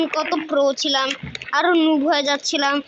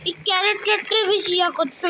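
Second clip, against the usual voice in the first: ordinary delivery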